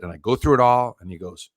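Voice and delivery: ordinary talk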